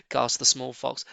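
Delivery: usual speaking voice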